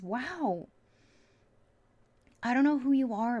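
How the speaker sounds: bubbling over with real delight; real giddy excitement. Real, not acted. Reader giddy over the cards for her viewer, excited. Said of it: "I'm a little giddy right now".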